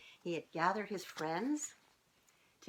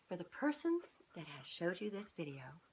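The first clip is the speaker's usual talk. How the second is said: ordinary delivery, nothing marked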